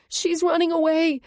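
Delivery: very high, loud alarm scream; shrieking to alert the men. A narrator's reading. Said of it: "she screams".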